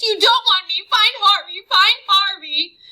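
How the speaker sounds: high, loud tearful whining; boy begging the vampire; whining plea. Acted. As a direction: whine it through snot and tears. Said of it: "Wendell whined".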